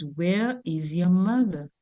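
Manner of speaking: slow, soft "Where is your mother?"; slow plain translation. Shown as a demonstration. Teacher translating the Creole line, slow and soft. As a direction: translate it for them, slowly. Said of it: "all they're saying is".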